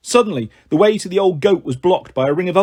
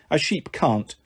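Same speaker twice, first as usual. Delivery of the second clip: ordinary delivery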